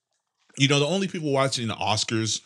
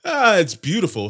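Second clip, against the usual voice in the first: higher, mock-admiring sigh; sarcastic; 7 semitones higher, 5 dB louder than usual. Real, not acted. Host mocks his own stuffy nose, sarcastic praise. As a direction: call it beautiful when it isn't.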